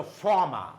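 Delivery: former said "faw-ma"; silent R. Shown as a demonstration. Teacher showing R is silent, silent R. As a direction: drop both R sounds in former. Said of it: "it's silent".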